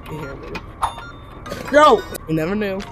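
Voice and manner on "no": shouting. Real, not acted. Refusing to give it up.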